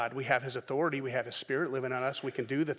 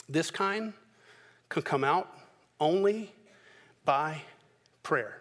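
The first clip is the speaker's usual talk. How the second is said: each word set apart: This kind, only, by, prayer; slow, deliberate, word by word; measured pauses; slower than usual. Acted. Jesus answering the disciples word by word. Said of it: "Jesus' response".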